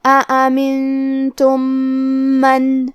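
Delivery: slow chanted recitation, the two meems merged into one nasal meem; recitation with ghunna and shadda. Shown as a demonstration. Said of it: "غنہ اور تشدید کے ساتھ پڑھیں گے". (آہستہ تلاوت، دونوں میم ملا کر غنہ کے ساتھ)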